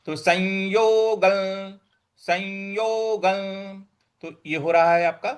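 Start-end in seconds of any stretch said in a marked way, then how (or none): 0.2-3.8 संयोगल with ल joined, slow; slow recitation. Shown as a demonstration. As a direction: join the ल onto संयोगल slowly. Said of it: "तो ल को इसके साथ जोड़ना है". (ल जोड़कर धीरे)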